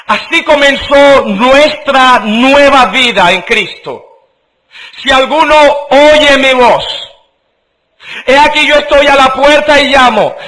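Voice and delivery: high, loud pulpit voice; full-force sermon reading of scripture; fervent preaching. Acted. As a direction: proclaim it to the congregation.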